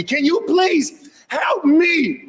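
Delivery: slow, high-pitched comic plea; imitated scream for help. Lady looking dead at neighbor, mock high pleading. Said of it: "screaming for help".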